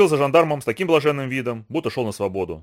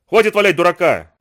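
loud, higher angry bark; 4 semitones higher, 6 dB louder than usual. A narrator's reading. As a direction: bark it like a sergeant. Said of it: "рявкнул унтер". (громкий рявкающий окрик)

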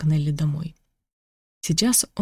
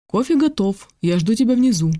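raised, higher voice calling someone; 5 semitones higher, 4 dB louder than usual. A narrator's reading. Host calling guest down for coffee, calling out. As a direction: call it out to someone in another room. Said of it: "крикнула Рената".

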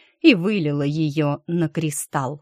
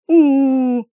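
high pained howl; 7 semitones higher, 8 dB louder than usual. Acted. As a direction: wail it out loud. Character howls in pain.

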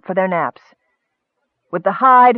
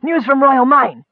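high, urgent messenger's cry; gasped announcement; 8 semitones higher, 4 dB louder than usual. A narrator's reading. Young runner bringing news to his leader, gasping. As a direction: burst in with big news. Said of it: "the newcomer gasped, panting for breath".

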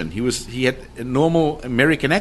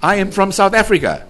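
put-on South African accent; 8 semitones higher, 3 dB louder than usual. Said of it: "answered me in a South African accent".

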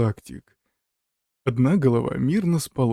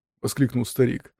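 ordinary delivery, nothing marked

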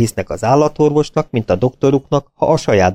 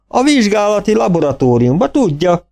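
farmer voice; 10 semitones higher than usual. A narrator's reading.

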